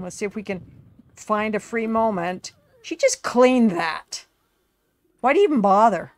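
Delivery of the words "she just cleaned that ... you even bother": louder, fed-up complaint; annoyed player scolding his sims, louder; exasperated outburst. Real, not acted. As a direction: throw up your hands at the mess. Exasperated at sims messing house.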